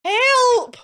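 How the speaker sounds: very high, loud scream for help; panicked yell; cry for help. Acted. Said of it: "And the other one cried".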